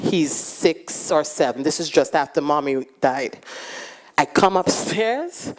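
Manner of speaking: ordinary talk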